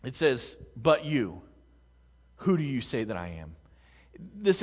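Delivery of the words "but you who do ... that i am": "you" set apart and stressed, then a pause; emphasis on YOU. Shown as a demonstration. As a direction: lean on the word "you", unhurried and pointed. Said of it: "The, the emphasis here".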